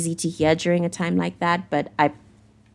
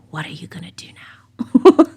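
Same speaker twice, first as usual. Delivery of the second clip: whispered voice of the universe's big eye; eerie whisper; 5 dB louder than usual. Acted. Tarot reader voicing the universe watching her viewer, eerie whisper. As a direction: breathe it like a cosmic watcher looming over you.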